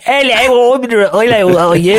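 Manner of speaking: high, exaggerated mock-British hello; overdone British greeting. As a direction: ham up the posh Brit showing off. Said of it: "They'd be like".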